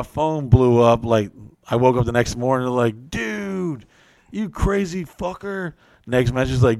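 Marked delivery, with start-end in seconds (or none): 3.1-5.7 higher, slower put-on buddy voice; mock bro voice. Friend texting him after the post, mock bro. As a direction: read the text like an impressed bro.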